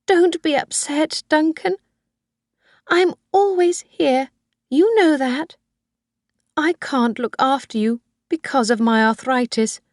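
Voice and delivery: put-on high old woman's voice, clinging and sweet; Missus Harvey, doting old mother. Old mother comforts son.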